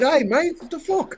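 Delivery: high, mock Aussie indignation; kangaroo's outrage. Kangaroo confronting the dog rescuer, high mock outrage. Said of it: "He said".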